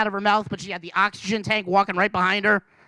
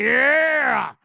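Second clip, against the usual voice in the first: excited; 5 semitones higher, 3 dB louder than usual. Retold or imitated. Celebrating the win.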